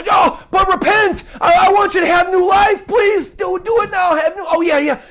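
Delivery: high, loud, pleading call to repent; urgent pleading cry. Acted. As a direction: beg them to repent right now. Preacher voices Peter's urgent plea.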